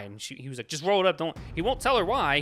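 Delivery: ordinary delivery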